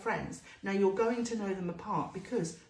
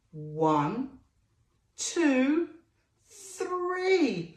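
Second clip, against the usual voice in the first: counting aloud; 5 semitones higher, 4 dB louder, much slower than usual. Shown as a demonstration. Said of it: "Can you count to three?".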